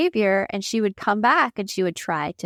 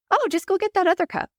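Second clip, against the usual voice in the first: breezy dismissive husband; 11 semitones higher, faster than usual. Retold or imitated. Husband dismissing wife's upset.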